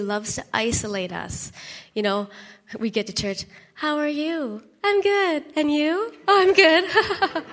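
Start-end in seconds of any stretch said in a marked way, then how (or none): 3.7-7.4 bright, high put-on cheer; fake cheerful. Churchgoers trading chirpy fake I'm-goods. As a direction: chirp the polite lie.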